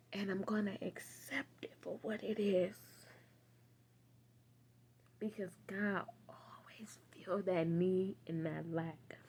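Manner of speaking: soft whispered resolve. Real, not acted. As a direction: whisper it like a prayer. Quiet prayerful resolve.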